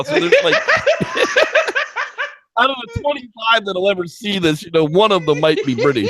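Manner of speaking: laughing hard through the line, pitch jumping up. Real, not acted. Joking to his cohost about four viewers, laughing. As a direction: crack up while making the joke.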